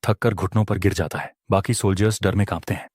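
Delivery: ordinary delivery, nothing marked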